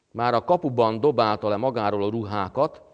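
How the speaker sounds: normal talking voice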